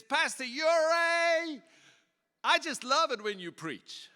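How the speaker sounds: put-on high, slow, drawn-out elderly lady voice; old lady voice. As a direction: play the sweet old church lady, stretching every word. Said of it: "she was coming with a walker".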